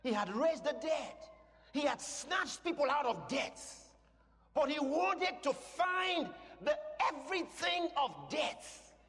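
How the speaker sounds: high, emphatic preacher's build; full-force preaching. Real, not acted. Preacher telling the congregation how he wanted to overcome death, full-force preaching. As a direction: build line on line to a shouted peak.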